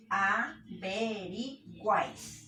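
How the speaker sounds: AVERIGUÁIS very slowly split, a-ve-ri-guáis; syllable-by-syllable demo. Shown as a demonstration. Teacher giving a triphthong example, slow separated syllables. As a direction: break up AVERIGUÁIS, keeping GUÁIS as one glide. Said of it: "Acá en guáis encontramos".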